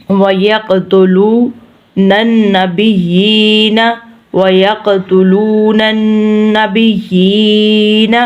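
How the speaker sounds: slow, doubled n hummed in "النَّبِيِّينَ"; ghunna recitation. Shown as a demonstration. Teacher modelling ghunna, slow nasalised recitation. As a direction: hold nasal n and long ee of "النَّبِيِّينَ". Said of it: "گننا کرنا ہے".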